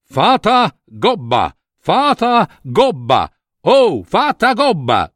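slow, drawn-out summons; stretched-out name calls, rising by steps; calling out. Acted. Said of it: "cominciò a chiamare".

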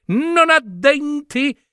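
the anxious dog owner pleading her case; fussy lady's voice. Acted. Woman explaining her dog to the narrators, high and pleading.

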